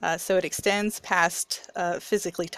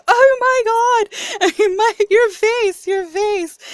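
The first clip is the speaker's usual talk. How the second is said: much higher, louder, half-laughing half-crying shriek, slowed; shocked laughing mom; 11 dB louder, much slower than usual. Retold or imitated. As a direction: imitate mom losing it with laughter at the sight. Mom shocked by missing tooth.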